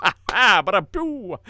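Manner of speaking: loud, high mock laugh and drum sting; mock rimshot. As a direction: punch your own joke with a voiced rimshot. Jokes, then imitates a rimshot.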